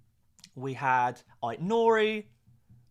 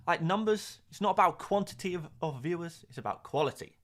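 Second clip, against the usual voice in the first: normal talking voice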